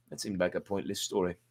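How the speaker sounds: usual speaking voice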